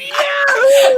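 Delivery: host cheers as Kevin joins, whooping. Real, not acted. Cheering a guest's arrival.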